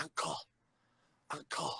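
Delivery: very soft, low, imitated dazed child; faint murmur. Retelling the boy asking for warmth after his seizure, faint murmur. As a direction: barely voice it, as a weak boy would.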